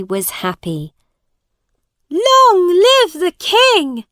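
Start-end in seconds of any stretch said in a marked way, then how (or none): 2.1-4.0 high, drawn-out cheer; crowd's shout. A narrator's reading. Crowd hails the new king at the parade, shouting. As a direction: shout it loud, high and slow. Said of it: "they shouted".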